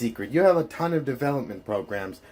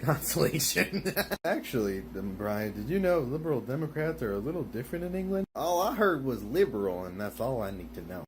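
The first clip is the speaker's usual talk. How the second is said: ordinary delivery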